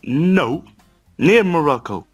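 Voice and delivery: ordinary talk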